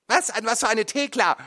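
high, loud, snapping; sharp retort. A narrator's reading. Mother snaps back at her son. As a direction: bite back at him.

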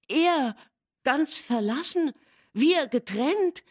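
high, loud agitated outcry; almost upset. A narrator's reading. Catherine to Nelly, horrified at being separated, agitated high cry. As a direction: cry it out, nearly beside herself. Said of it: "rief sie fast aufgebracht".

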